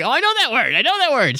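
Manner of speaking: high, eager recognition; thrilled to know the answer; excited. Retold or imitated. Excited quote, recognizing a word.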